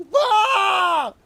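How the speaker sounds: very high, loud drawn-out "bah" shriek; playful scream. Retold or imitated. Dad imitating his wife yelling at the kid, playful scream. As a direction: imitate a playful yell at full volume. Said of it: "we playfully scream".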